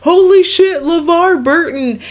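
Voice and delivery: high, loud shocked exclamation; excited shout. Retold or imitated. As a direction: yell it in starstruck disbelief. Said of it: "K K literally lost his mind".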